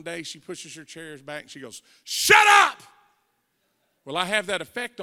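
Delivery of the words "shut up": high, loud imitated outburst; explosive mock-exasperated yell; exasperated shout. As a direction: yell it like a therapist at her limit.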